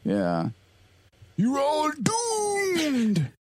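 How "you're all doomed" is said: very high, slow wailing doom prophecy; slow ominous raspy drawl. Retold or imitated. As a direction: cry it out like a mad old prophet. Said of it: "You did a pretty good impression of him".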